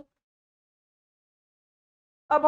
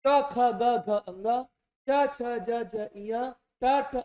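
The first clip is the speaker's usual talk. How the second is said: slow demonstration recital; slower than usual. Shown as a demonstration. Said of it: "अब स्वर का सहायता ले रहा हूं".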